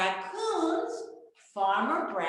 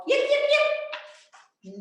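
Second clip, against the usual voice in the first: high, loud, slow little fox yips; 5 dB louder, slower than usual. Acted. Little fox calls toward the music, yipping high. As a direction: call out like a small fox, much higher. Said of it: "She perked up her ears and called".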